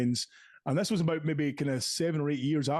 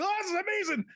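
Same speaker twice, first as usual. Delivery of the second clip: high, breathless mock gushing; gushing fan; faster than usual. Starstruck fan in the toilet gushing, high mock squeal. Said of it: "filling me with".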